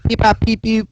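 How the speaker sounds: high beeping robot noises. Retold or imitated. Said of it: "you sound like a robot".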